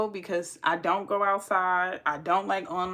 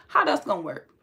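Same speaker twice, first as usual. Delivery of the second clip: normal talking voice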